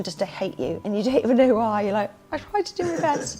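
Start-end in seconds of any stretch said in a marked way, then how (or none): 2.3-3.4 high, small self-pitying voice; comic wounded whine; mock plaintive protest. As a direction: plead your case in a put-on high voice. Mock protest to hostile children.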